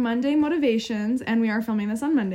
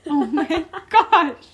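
slow, drawn-out laugh through the words; laughing in mock despair; high laughing groan at being talked over; exasperated laughing; 6 semitones higher, 7 dB louder, much slower than usual. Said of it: "I'm like".

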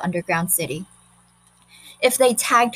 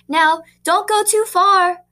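higher, louder, slow calling voice; teacher calling after; 10 semitones higher, 6 dB louder, slower than usual. A narrator's reading. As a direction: call it out to children running away. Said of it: "called the teacher after them".

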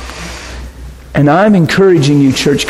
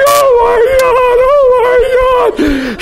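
high, slow, tearful cry of joy; much slower than usual. Retold or imitated. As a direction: wail it out like a man overcome with relief. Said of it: "crying, going".

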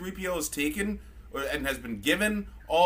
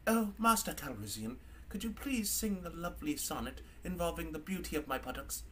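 much softer, mock courteous; mock polite droid; 10 dB quieter than usual. Drunk C-3PO fake-politely needling Lando. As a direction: purr it like a sly butler. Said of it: "He's all like".

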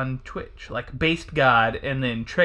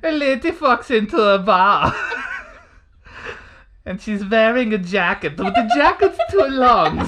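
high, loud sassy German man; hammed-up comic German accent, laughing through it; 5 semitones higher, 4 dB louder than usual. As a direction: do a campy German man telling a joke. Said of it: "What a German accent. Like a sassy German man".

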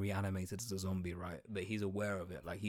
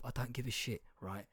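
usual speaking voice

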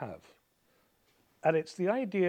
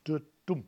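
light DU then heavier DUM; iamb demo. Shown as a demonstration. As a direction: sound out da-DUM as one foot. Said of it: "unstressed syllable and then a relatively stressed".

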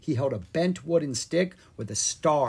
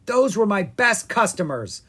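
angry; 7 semitones higher, 5 dB louder than usual. Acted. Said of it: "he said angrily".

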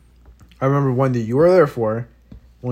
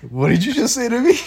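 pitched-up incredulous question; baffled disbelief; 9 semitones higher, 3 dB louder than usual. Retold or imitated. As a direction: ask it like you can't believe what you heard. Kevin baffled by odd question.

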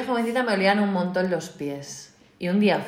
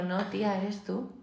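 much softer, flat-out telling her; blunt quiet verdict; 13 dB quieter than usual. Retold or imitated. Classmate quietly telling her the smell is her feet, much softer. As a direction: drop the voice to break the news. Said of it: "mi compañera me dijo".

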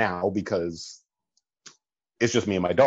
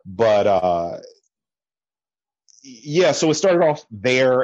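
normal talking voice

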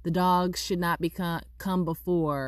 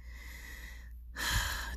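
long, soft deep breath; 9 dB quieter than usual. Acted. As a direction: take a slow calming breath. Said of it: "taking deep breaths".

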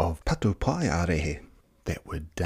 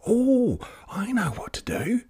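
gasped sudden idea; gasping. A narrator's reading. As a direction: catch a breath, then whisper. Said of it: "Te Rere Hua gasped".

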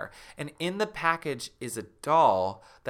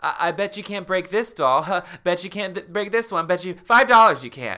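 high, playful taunt, louder at the end; teasing dare; 6 semitones higher, 6 dB louder, faster than usual. Acted. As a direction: egg the kid on. [name] taunting Neil.

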